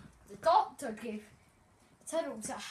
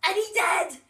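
excited; 7 semitones higher, 14 dB louder than usual. A narrator's reading. Surprise twist in story.

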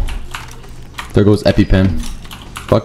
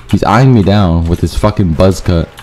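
ordinary talk, nothing marked

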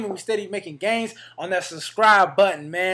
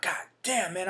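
muttered to himself; 10 dB quieter than usual. Retold or imitated. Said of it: "and I'm like".

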